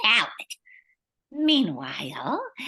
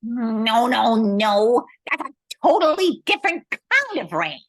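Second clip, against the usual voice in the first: loud, much higher, exasperated no-no-no; exasperated correction; 3 semitones higher, 5 dB louder than usual. Real, not acted. Host cuts off co-host's silly reindeer theory, loud and exasperated. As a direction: shut the silly idea down with loud impatience.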